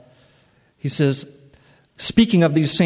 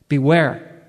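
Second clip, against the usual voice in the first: a raised, ringing warning to the church; emphatic warning; 4 dB louder than usual. Real, not acted. Preacher warning the saints, louder and ringing. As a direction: call it out so it carries.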